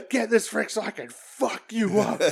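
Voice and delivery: high laughing mock tough-guy promo; imitating Juice, laughing. Retelling Juice's promo to co-host, laughing tough-guy voice. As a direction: ham up a wrestler's threat while laughing. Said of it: "It's like".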